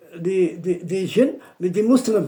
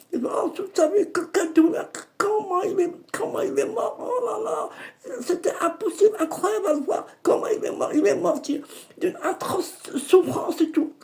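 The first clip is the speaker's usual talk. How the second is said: high, whining lament, much higher pitch; high lamenting moan; 13 semitones higher than usual. Retold or imitated. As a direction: moan it in the djinn's voice. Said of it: "Comme ça, il disait". (plainte aiguë du djinn)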